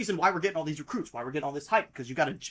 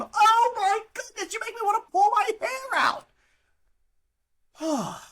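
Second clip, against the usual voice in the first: exasperated wail; 14 semitones higher, 3 dB louder than usual. Real, not acted. Fan venting at the coach, near tears and wailing. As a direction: wail it like you're at your wits' end. Despairing over the team's loss.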